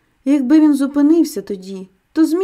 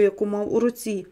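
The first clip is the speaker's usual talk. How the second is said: usual speaking voice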